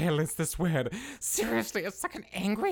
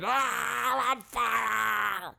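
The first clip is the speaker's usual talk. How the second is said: raised, long drawn-out scream; agonized wail; 4 semitones higher, much slower than usual. Acted. Villain catching fire, wailing scream. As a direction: wail it slowly in comic agony.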